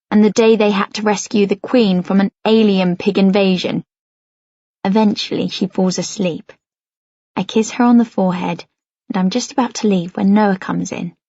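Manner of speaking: usual speaking voice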